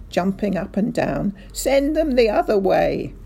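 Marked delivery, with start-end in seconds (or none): 1.5-3.1 raised, higher-pitched angry call; bellowing. A narrator's reading. Mister Hazel orders the birds turned round, bellowing. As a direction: bellow the order, somewhat restrained. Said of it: "Mister Hazel bellowed".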